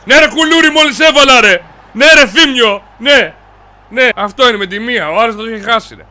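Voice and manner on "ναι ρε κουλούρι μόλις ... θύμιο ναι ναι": loud, higher cheering; jubilant shout. Real, not acted. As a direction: celebrate the goal at full voice. Celebrating a goal. (πανηγυρική κραυγή)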